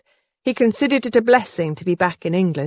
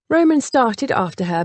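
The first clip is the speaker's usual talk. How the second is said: ordinary delivery, nothing marked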